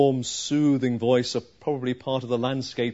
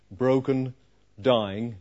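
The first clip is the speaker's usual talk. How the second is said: ordinary delivery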